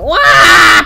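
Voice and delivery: short loud high "wah"; hammed-up cry; mock death yelp. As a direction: yelp as the character dies. Voices character dying.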